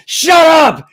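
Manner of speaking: loud, high shout out the back door; yelling at the dog. Retold or imitated. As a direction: yell to shut the barking dog up. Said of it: "then you go outside".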